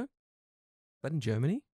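slow mock-innocent question with a long rise; feigned ignorance. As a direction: play dumb, stretching the question out. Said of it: "just to pretend you don't know".

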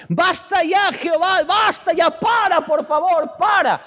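sustained high-pitched pleading yell; acted-out prophet at breaking point; shouting at God. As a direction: beg over and over, crying out. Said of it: "empieza a gritarle a Dios".

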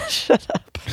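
teary laugh, breathy and high; laughing through tears. Real, not acted. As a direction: laugh it off while emotional. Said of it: "Just let it out".

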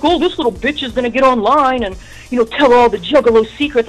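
sneering put-on voice; scornful mock voice. Mocking Lavelle, voiced quote.

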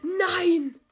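loud, high cry of "Nein!"; gamer's howl of frustration; anguished yell. Real, not acted. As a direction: scream it in despair. Monsters ruin his plan.

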